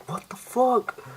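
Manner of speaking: very high, squeaky shocked exclamation; mock disbelief. Eiji seeing a girl's superhuman strength, mock shocked squeal. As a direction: act out stunned disbelief, pitch shooting up.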